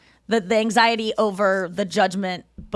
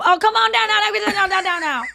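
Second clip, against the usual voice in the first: high, loud mock panic in a put-on accent; imitated ballgame yell; 10 semitones higher, 4 dB louder than usual. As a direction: yelp at a foul ball, imitating a fan. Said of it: "This is the accent that comes out".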